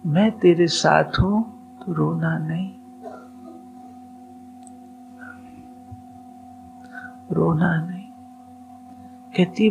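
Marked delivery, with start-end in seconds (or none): 0.1-8.1 soft divine voice. Retold or imitated. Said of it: "इसको आवाज आती है".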